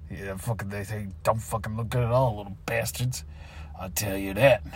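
low, quiet grumble; soft, low, spiteful aside; resentful muttering. Acted. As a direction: mutter insults behind the back. Grumbling once coworker is past.

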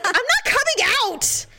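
put-on high, whiny kid refusing; whiny refusal. Girl in fitting room refusing mom, high whiny refusal. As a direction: whine it from behind the door. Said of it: "it's like".

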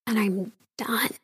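whispered, barely voiced; whispered final decision. Real, not acted. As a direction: drop to a whisper of resolve. Quiet resolve after church story.

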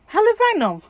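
high-pitched repeat. Real, not acted. Answering own question why it was needed, comically high-pitched.

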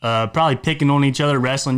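normal talking voice

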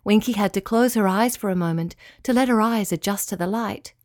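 ordinary delivery, nothing marked

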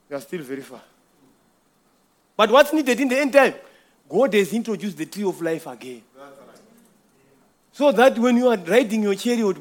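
usual speaking voice

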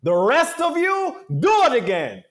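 high, loud, slow teacher voice showing off to the class; imitating the acting professor. Acting professor lecturing the class about the broken student, high and loud. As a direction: announce it to the whole class, drawn out. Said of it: "and he's like".

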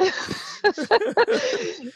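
high burst of laughter. Real, not acted. Woman laughs at her own comeback, high and bright.

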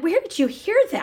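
high, incredulous question; shocked mom. Retold or imitated. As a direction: demand to know where he heard it.

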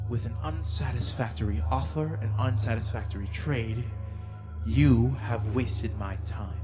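a harsher, colder fae queen. Acted. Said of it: "the Fey Queen's voice get a little bit harsher in tone".

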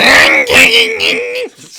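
very high, slow, loud honk; loud, high cartoon-penguin squawk; Pingu impression. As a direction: stretch it out like Pingu. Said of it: "They sound like".

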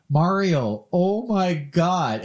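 high, slow, drawn-out exasperation; exasperated friend. Retold or imitated. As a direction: stretch it out, fed up. Friend's exasperated reaction quoted.

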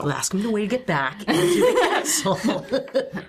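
slow, laughing through words. Real, not acted. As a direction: laugh as you say it. Laughs while proposing the plan.

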